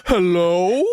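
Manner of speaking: high, hammed-up showman phone greeting; playful mock stage holler. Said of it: "he's like".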